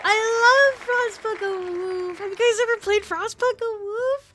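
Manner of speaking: high, loud burst of delight; thrilled squealing. Real, not acted. Streamer learns they're playing Frostpunk, thrilled squeal. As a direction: squeal it like a fan who can't contain it. Thrilled at getting favourite game.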